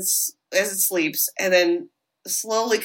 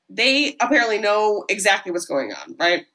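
ordinary delivery

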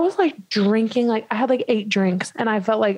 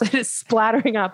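ordinary delivery

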